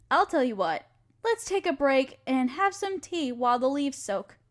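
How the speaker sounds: ordinary delivery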